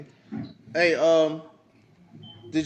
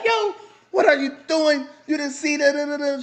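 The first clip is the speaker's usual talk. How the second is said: lightly voiced angry old lady, pitched up; mock scream; 8 semitones higher than usual. Hammed-up high old lady yelling at him. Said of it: "So she screaming".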